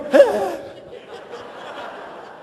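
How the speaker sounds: airy nervous chuckle; 1 breathy laugh. Retold or imitated. His own nervous laugh.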